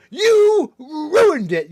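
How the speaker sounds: very loud, high, slow wail; drawn-out mock outrage. Mocking officer over ruined plans, drawn-out mock outrage. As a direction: stretch the accusation hugely, hammed up.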